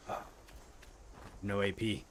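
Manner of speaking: ordinary delivery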